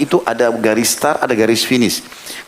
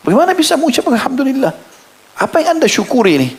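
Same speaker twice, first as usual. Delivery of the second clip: astonished question; 14 semitones higher than usual. Acted. Astonished messenger questions the believer.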